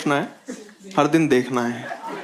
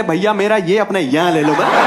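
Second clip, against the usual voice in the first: mock pleading tone; 3 semitones higher, 5 dB louder than usual. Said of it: "ये किस tone में बात करने लगा मुझसे".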